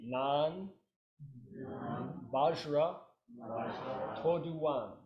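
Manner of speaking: each syllable set apart with a pause; spaced out for the class to repeat. Shown as a demonstration. Teacher modelling each sound for students to copy. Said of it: "Repeat after me".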